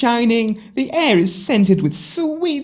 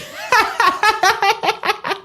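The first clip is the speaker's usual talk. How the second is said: greedy giggle; 13 semitones higher than usual. Acted. Sister giggles about rich suitor.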